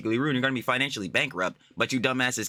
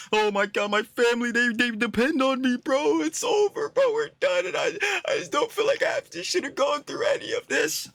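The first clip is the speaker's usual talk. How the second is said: high whiny mock sobbing, much higher; mocking crybaby meltdown; 13 semitones higher than usual. Mocking the snarker's crybaby meltdown, high and whiny. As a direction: whine it like a crybaby falling apart. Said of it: "a crybaby bitching meltdown".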